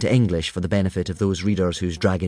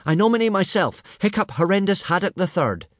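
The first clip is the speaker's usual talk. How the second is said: much higher, proud announcing call; shouting; 10 semitones higher than usual. A narrator's reading. Hiccup leaps up and announces himself, much higher. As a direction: stand up and claim it.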